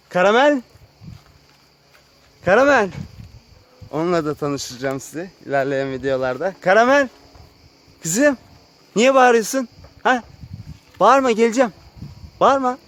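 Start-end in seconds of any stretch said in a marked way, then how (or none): 0.1-2.9 high, loud name call; pitched-up, carrying call; calling a pet. Real, not acted. Calling the animal Karamel. 6.6-12.8 high, loud coaxing voice; pet talk. Real, not acted. Answering the noisy animal Karamel.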